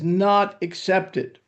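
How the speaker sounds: "not accepted" drawn out slowly, every word leaned on; emphatic, slow. Shown as a demonstration. Preacher stresses the scripture's verdict to listeners, slow and emphatic. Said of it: "I emphasize".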